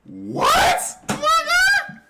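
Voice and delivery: very high, loud cry of disbelief; shocked shriek. Real, not acted. Viewer stunned by a sudden coming-out, shocked shriek. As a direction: shriek it in real astonishment.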